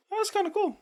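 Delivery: high, lightly impressed. Retold or imitated. Retold own early reaction.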